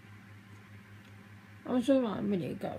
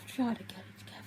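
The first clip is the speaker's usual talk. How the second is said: whisper to herself; 6 dB quieter, faster than usual. Real, not acted. Pulling herself together while crying.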